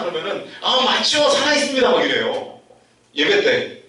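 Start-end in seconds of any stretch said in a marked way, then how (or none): 0.6-1.9 higher, louder enthusiastic response; imitating an excited churchgoer; eager congregation reply. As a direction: shout back agreement like a congregant. Congregation shouting agreement.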